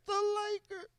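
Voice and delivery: tiny high far-off answer; faint echo. Acted. Hidden team answers the comic, faint distant echo. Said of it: "First pussy echo".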